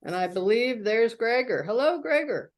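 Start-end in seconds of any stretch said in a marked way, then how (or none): none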